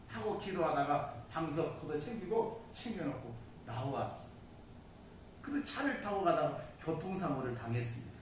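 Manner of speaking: ordinary talk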